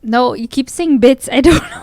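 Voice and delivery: loud exasperated protest. Real, not acted. Frustrated by repeated word.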